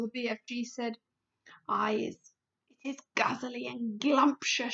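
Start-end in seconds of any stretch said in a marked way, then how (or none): none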